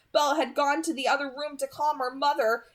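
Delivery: ordinary delivery, nothing marked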